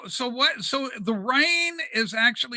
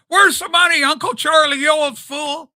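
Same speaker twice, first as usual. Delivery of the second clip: high, loud mock George Bailey rage; despairing shout; 9 semitones higher, 8 dB louder than usual. Host acting out Jimmy Stewart yelling at Uncle Charlie. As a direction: bellow it like a cornered, ruined man. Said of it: "then he goes through despair".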